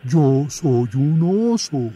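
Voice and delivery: oso firme y sencillo; bear folk. Acted. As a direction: explica con calma que es oso. Oso insiste. (oso que protesta)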